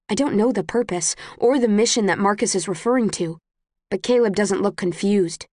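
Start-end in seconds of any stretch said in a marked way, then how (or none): none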